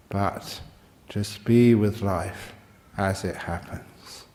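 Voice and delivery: slow, hushed meditation teacher; meditative guidance. Acted. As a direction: ease listeners into letting go, leaving long pauses. Slows into meditative instruction.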